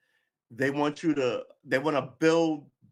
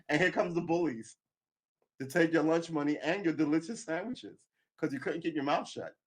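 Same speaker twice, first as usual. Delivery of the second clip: normal talking voice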